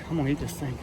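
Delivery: normal talking voice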